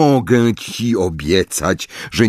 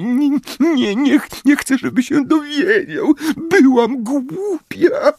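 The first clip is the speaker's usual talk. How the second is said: weeping, much higher; crying plea. Acted. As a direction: break down in shame. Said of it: "zawodziła pani Wesley".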